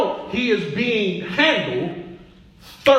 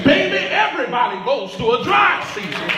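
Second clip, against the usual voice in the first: preaching shout; 6 semitones higher than usual. Real, not acted. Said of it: "preaching climax".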